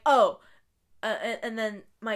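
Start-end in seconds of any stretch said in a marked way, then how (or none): 0.1-0.4 loud, offended "Oh.". Retold or imitated. Customer's offended reply.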